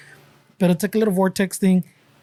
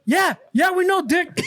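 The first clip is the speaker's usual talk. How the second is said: irritated shout; 8 semitones higher, slower than usual. Retold or imitated. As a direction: snap back at the neighbor. Shouted retort at hipster.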